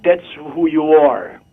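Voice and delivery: ordinary talk, nothing marked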